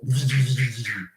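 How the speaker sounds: buzzing zaps in a quick repeated burst; voiced electric sound effect; imitated electrical zapping. Shown as a demonstration. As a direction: make the noise of current crackling down a pole. Imitates electricity running down the pole.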